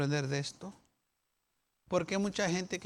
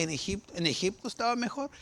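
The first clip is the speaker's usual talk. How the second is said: normal talking voice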